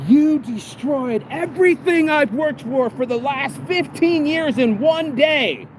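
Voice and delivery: high, drawn-out retold yell; imitated scream. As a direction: act out a man screaming, stretching the words. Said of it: "he starts screaming at him".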